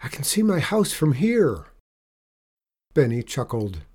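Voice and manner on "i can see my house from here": put-on high, excited boy calling out; delighted call. A narrator's reading. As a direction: pitch jumps up with delight. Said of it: "called out with delight".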